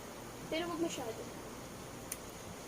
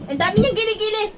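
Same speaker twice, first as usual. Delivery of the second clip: amused exclamation; 7 semitones higher, 11 dB louder, faster than usual. Real, not acted. Laughing at armpit hair.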